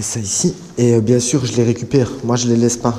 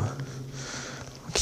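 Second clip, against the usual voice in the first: whisper; 18 dB quieter than usual. Real, not acted. Quiet tunnel, showing hidden plans.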